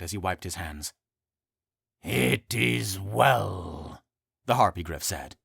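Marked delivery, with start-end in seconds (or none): 2.0-4.0 slow, grave creature voice. Acted. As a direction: speak slow and solemn. Harpygriff slow deep voice.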